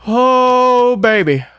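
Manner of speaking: high, loud, stretched out; excited cry. Real, not acted. Gamer nearly clears the level, high excited cry. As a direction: squeal with the thrill. Close call in game.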